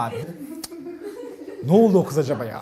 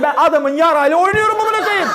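normal talking voice

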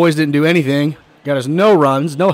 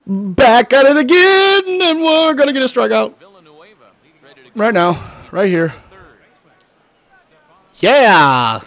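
hype voice; 10 semitones higher, 5 dB louder, slower than usual. Acted. Hyping the next inning.

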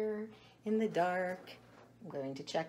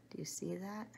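whisper; 8 dB quieter than usual. Real, not acted. Hushed while showing egg.

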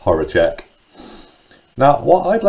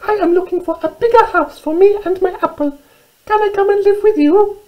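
very high, squeaky mouse voice; little mouse; 3 dB louder than usual. Acted. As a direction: squeak it out like a tiny storybook mouse. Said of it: "she said".

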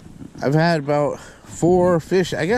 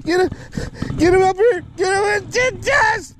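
very high, urgent shouting; real frantic excitement. Real, not acted. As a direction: shout it high while hauling in a catch. Landing the fish after fight.

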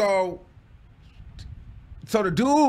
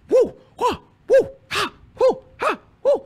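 very high, loud imitated moans; mock moaning woos; 6 dB louder than usual. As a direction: ham up the rhythmic moans. Mimicking sex sounds in story.

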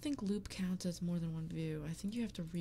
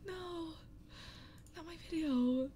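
despairing no; 8 semitones higher, slower than usual. Real, not acted. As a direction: plead with the screen in despair. Distress at failing video.

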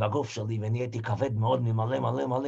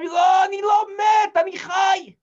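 high, loud, slow desperate yell; shouting that he is not dead; 8 dB louder, slower than usual. Acted. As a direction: scream the denial at them. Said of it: "אני צועק להם".

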